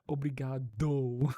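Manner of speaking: obrigado with a big, loud, drawn-out O at the end; slow, loud "Obrigado", final O made obvious; exaggerated final O. Shown as a demonstration. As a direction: lean hard on the O closing "Obrigado". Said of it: "I have to make the O apparent".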